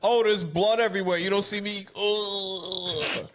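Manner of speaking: mock panic. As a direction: fake freaking out at a gory sight. Voicing someone panicking at blood.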